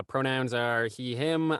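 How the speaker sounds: ordinary delivery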